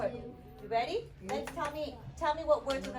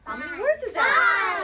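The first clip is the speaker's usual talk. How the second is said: high, loud question to the class; loud call; 12 semitones higher, 12 dB louder, slower than usual. Real, not acted. Teacher calling out to class.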